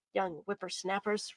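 low, slow, soft aside; thinking to himself. A narrator's reading. Detective thinks to himself about youngsters, thinking to himself. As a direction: grumble it privately under your breath. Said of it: "Rigel thought to himself".

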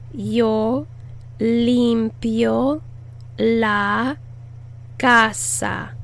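yo, limpio, la, casa with pauses; pausing word-by-word model. Shown as a demonstration. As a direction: say each word apart. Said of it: "word-by-word model".

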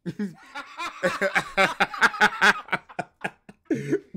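high, loud helpless laughter rising; real laughing fit; long laugh. Real, not acted. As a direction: laugh until you can't speak. Laughing hard.

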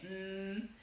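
"mm" as a long N hum, tongue behind the teeth; sustained soft nasal N sound. Shown as a demonstration. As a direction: hold a soft, high N. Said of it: "son larguísimas".

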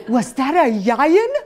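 put-on slow childlike question, laughing; little girl. Toddler asking in church about the lion noise, laughing. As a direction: ask like a wide-eyed toddler, slowly. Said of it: "she goes".

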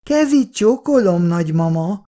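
put-on high child voice, echoing the greeting; small boy repeating. A narrator's reading. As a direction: chirp it like a little boy copying his dad. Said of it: "Petike utána mondja". (magas, gyerekes hangon utánamondva)

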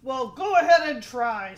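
loud, defiant goat; biggest billy goat. A narrator's reading. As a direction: dare the troll loudly. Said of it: "said the biggest billy goat".

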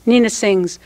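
plain reading voice

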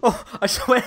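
ordinary talk, nothing marked

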